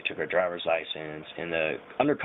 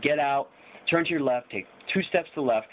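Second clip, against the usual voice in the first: raised-pitch imitation of police commands; mock cop orders; 6 semitones higher than usual. Cop directing drunk passenger out, lightly mocked command voice. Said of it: "He said".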